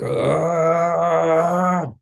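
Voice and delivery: long, high strained "ah"; imitated scream. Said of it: "kinda s-, like, screaming".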